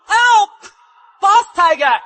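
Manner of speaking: high, loud cry for help; yelling for help. Acted. Frozen henchman yelling to his boss for help, high and loud. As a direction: scream for the boss to save them.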